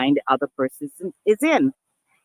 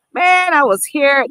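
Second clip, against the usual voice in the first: playful teasing; 8 semitones higher, 5 dB louder than usual. Retold or imitated.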